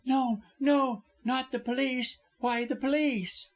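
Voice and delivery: high-pitched alarmed protest; panicked woman refusing; terrified. A narrator's reading. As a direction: plead against it in a high voice. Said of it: "with a terrified gesture".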